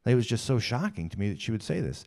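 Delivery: ordinary talk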